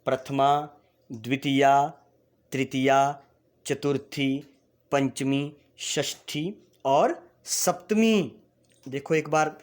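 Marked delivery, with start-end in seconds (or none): 0.0-8.3 case names called out one by one, with pauses; drilled classroom recitation; recitation for repeating. Shown as a demonstration. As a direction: recite them as a list for the class to echo. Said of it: "मेरे साथ एक बार उच्चारण करेंगे".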